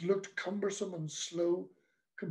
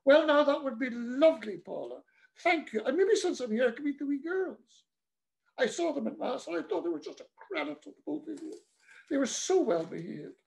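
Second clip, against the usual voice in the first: put-on higher, chirpy old priest; delighted priest; 7 semitones higher than usual. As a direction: beam and accept the tea. Priest voiced in story.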